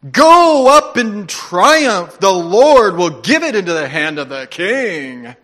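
loud, drawn-out mock-heroic proclamation; juicy sarcasm. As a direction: lay the sarcasm on thick, booming and stretched. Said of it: "he said it with, with, with such juicy sarcasm".

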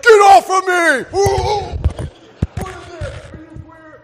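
high, loud shout of recoil; a startled, disgusted yell; mock shout. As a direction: yell it like you're shoving him off.

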